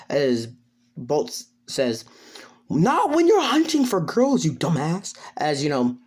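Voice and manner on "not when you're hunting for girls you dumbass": high-pitched exasperated comeback; high, indignant character line; exasperated retort. Acted. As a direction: scold a clueless friend, voice jumping high. Acted quarrel, character snapping back.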